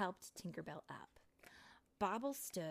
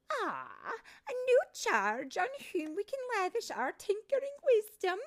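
much higher, louder fussy matron voice; a lively old tinker boss; bossy head fairy; 11 dB louder than usual. Acted. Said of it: "she said".

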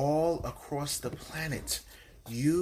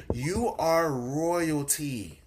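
ordinary talk

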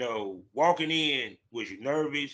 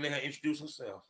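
whisper; 8 dB quieter than usual. Real, not acted. Host hands over to guest.

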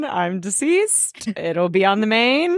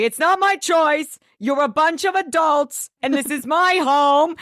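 higher, indignant mock scolding; raised-pitch, emphatic impression; Dorinda; 6 semitones higher than usual. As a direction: impersonate an outraged hostess. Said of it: "Dorinda's like".

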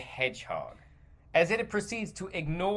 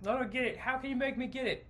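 clueless Hollywood exec; 9 semitones higher, faster than usual. Retold or imitated. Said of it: "they're just like".

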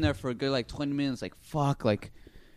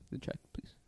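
breathy whisper, barely voiced; whispered request; 16 dB quieter than usual. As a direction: mouth it quietly to the waiter. Quiet mimed check request.

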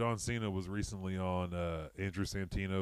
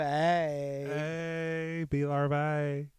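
goofy voice; 4 semitones higher, much slower than usual. Pretend. Goofy voice bee larvae.

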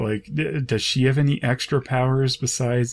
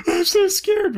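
mock scared. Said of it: "Maggie's sitting around like".

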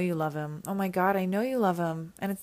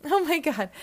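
loud, high burst of delight; excited gasp; 10 semitones higher, 9 dB louder than usual. Acted. Reading the fan's letter aloud, bursting with excitement. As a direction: gush it out, much higher and louder.

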